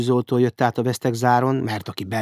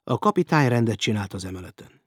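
ordinary delivery

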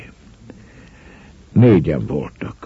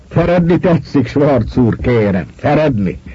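amused, higher-pitched polite teasing; smiling question; 8 semitones higher than usual. A narrator's reading. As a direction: ask with a smile in the voice. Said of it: "kérdezte az egyik mosolyogva". (mosolygós, udvarias kérdés)

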